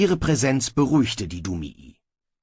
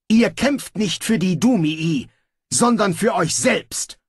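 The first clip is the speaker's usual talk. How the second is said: raised, forceful angry retort; fierce; 8 semitones higher, 3 dB louder than usual. Acted. Snibral holding the dwarf by collar, fierce. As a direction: drive it into his face.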